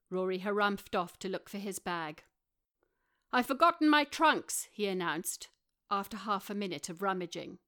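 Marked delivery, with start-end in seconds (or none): 3.3-4.6 higher, louder dismayed announcement; Rory's announcement. A narrator's reading. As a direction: declare the mishap loudly. Rory announces lost trunks.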